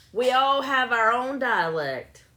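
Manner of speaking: high, slow, drawn-out declaration; loud proclamation. Real, not acted. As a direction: proclaim it loudly, stretching the words. Loud defence of own accent.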